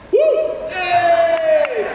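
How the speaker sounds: high squeal of joy; happy squeal for Puerto Rico; excited cheer. Real, not acted. Audience cheer reply.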